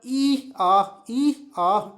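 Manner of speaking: loud, two-part donkey bray; teacher imitating a donkey's hee-haw. Shown as a demonstration. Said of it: "Dunki कैसे sound निकालता है".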